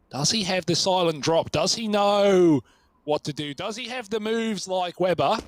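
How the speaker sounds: excited esports call, stretching the key words; suspenseful play-by-play. Real, not acted. Live play-by-play, suspense building.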